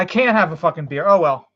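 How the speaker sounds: ordinary delivery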